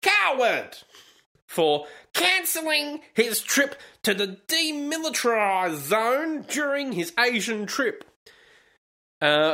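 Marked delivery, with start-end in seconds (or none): 0.0-8.0 high, hammed-up propaganda voice; mock newspaper denunciation. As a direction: pitch it up like an outraged state bulletin. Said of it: "the newspaper also described Mister Trump as".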